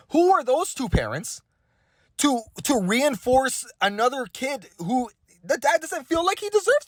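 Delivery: high, heated rant; indignant. Real, not acted. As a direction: argue hotly that it's none of their business. Indignant rant defending the dad.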